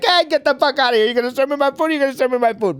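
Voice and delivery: high, fast, loud mock rant; hammed-up Italian father blowing up; irate Italian dad. Italian dad scoffing at the person serving his food, mock irate. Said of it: "if it's an Italian guy".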